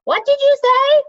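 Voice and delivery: high, loud yell over the roar. A narrator's reading. Telemain yelling at Mendenbar over the waterfall, high loud shout. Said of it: "Telemain yelled".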